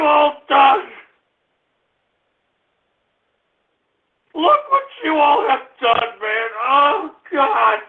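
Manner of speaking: high, slow, whining blame; tearful accusation. Real, not acted. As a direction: wail it at them in misery. Accusing trolls while sick.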